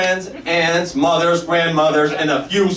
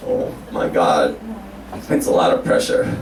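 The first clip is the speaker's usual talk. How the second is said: very low, half-whispered; overwhelmed mutter; 14 semitones lower than usual. Real, not acted. Anxious fan muttering before Oprah's workshop, very low. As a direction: mutter it to yourself, dreading. Nervous about meeting Oprah.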